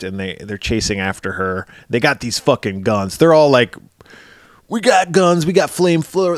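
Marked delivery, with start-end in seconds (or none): 4.7-6.4 bragging soldiers. Retold or imitated. Soldiers bragging about weapons.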